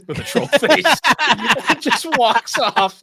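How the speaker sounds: high, loud words pushed out through real laughter; can barely get it out for laughing; laughing hard. Real, not acted. Cracking up retelling the scene.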